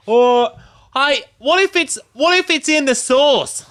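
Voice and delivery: high, loud put-on voice, slow at the end; mock clueless cop. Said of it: "I was like".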